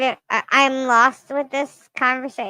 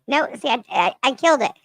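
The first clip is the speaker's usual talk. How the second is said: usual speaking voice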